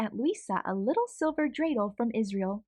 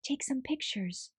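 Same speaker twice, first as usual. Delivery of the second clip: whispered; 4 semitones higher, 5 dB quieter than usual. Acted. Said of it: "she whispers".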